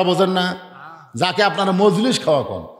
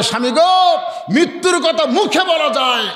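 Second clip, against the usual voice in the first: mock wifely wail; 9 semitones higher than usual. Preacher acting a wife answering her husband, mock protest. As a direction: exclaim it high like a protesting wife. Wife pleading in acted quarrel.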